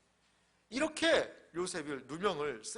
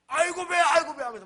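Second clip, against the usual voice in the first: loud, high wail of stomach pain; faked bellyache; 12 semitones higher, 13 dB louder than usual. Courtesan rolling on the floor to fool the pastor, faked agony. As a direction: cry out in hammed-up agony. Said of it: "막 방바닥을 떼굴떼굴 떼굴 부는 거예요".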